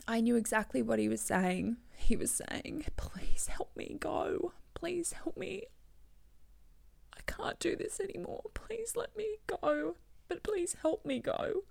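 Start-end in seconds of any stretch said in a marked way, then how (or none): 3.0-11.6 high, soft, breaking voice; tearful pleading. Retold or imitated. Voicing dying dad begging to go, tearful. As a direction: retell the plea through real tears.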